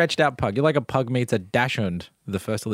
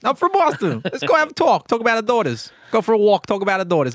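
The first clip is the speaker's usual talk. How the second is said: hammed-up Boston accent, higher and louder; a Boston dog owner proposing a chat, in a Boston accent; 9 semitones higher, 3 dB louder than usual. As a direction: play a chatty Boston dad in a Boston accent. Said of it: "did have a Boston accent".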